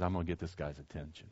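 very soft, low murmur; hushed resolve. Retold or imitated. Blind man resolving to reach Jesus, hushed resolve. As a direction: say it low, almost to yourself.

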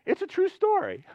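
high squeaky voice through real laughter; helpless, high-pitched giggly insistence; laughing protest. Real, not acted. Preacher insisting his joke is true, squeaking with laughter.

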